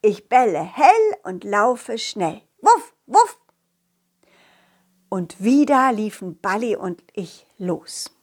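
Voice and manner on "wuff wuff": high, louder little woofs; playful woof. Acted. The dog barks happily before running on. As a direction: bark like a cheerful puppy.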